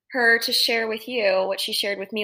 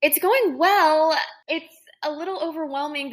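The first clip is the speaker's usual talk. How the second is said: excited; 7 semitones higher, 6 dB louder than usual. Real, not acted. Asked about first day.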